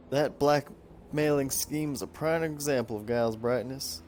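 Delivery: lightly drawled Texan reading of a diary; Texan accent. Acted. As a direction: read the journal with a light Texan twang. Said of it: "I don't know why I went for a Texan accent there".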